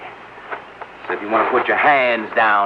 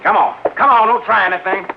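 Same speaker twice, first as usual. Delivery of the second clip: barked order rising higher and louder; angry shout; 7 semitones higher, 3 dB louder than usual. Acted. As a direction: force the captives out the door. Gunman ordering captives out.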